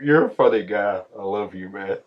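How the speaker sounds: normal talking voice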